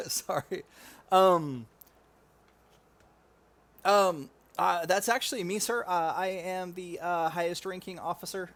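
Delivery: a hint of a nervous, stammering soldier. Soldier confessing his rank to an officer, sheepish.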